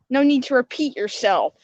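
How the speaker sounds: ordinary talk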